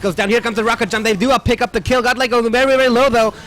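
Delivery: loud, high play-by-play; excited casting. Real, not acted. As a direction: call the kill at full volume. Caster hypes a fight.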